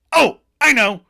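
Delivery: high, loud eureka burst; instant bright idea. Retold or imitated. Joey getting an instant gift idea, loud excitement. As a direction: blurt it as if struck by genius. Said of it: "Joey gets an instant idea".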